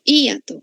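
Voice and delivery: "Hiato" as HÍ-a-to, stressed I apart from A; stress on weak vowel. Shown as a demonstration. Teacher repeats the hiatus version for students, "Hiato" with a stressed, separate I. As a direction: break "Hiato" after a stressed I. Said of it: "La débil va a tener la".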